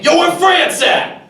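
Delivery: high, loud, slow shout; yelling. Retold or imitated. A rowdy guest yelling in the hotel lobby. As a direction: bellow it across the hotel lobby. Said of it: "I would not stop yelling".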